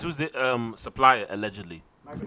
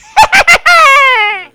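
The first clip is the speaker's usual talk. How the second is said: high shrieking witch cackle; 14 dB louder than usual. Acted. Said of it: "appreciate [name]'s witch cackle".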